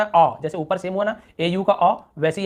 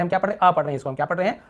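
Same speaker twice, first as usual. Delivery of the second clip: usual speaking voice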